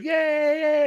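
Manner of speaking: very high mock cheer; retold gleeful cheer. Skinhead kid flying over the crowd, imitated cheer.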